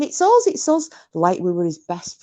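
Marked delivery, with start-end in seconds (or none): 0.0-0.9 high excited call; eager calling. Retold or imitated. Fan calling to a film star from a car, high eager repeat. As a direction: squeal it like he's an old friend. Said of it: "and I'm going".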